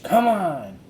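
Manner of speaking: high, loud eager call; eager girl's call. Acted. Annie urging Jack to follow, eager. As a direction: urge him to hurry.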